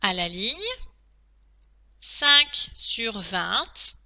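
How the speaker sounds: slow, deliberate dictation with pauses; dictating. Shown as a demonstration. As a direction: dictate each phrase clearly to pupils. Teacher dictating to class.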